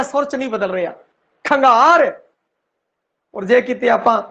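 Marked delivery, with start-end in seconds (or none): 1.4-2.2 ਖੰਗਾਰ with the gh kept whole, no tone drop; full aspirated gh. Shown as a demonstration. Teacher to students, repeating ਖੰਗਾਰ with the full aspirated gh. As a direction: say ਖੰਗਾਰ with a clear voiced aspirate. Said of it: "ਘੱਗਾ ਪੂਰਾ ਟਿਕਵਾ ਆ ਰਿਹਾ".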